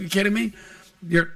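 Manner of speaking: normal talking voice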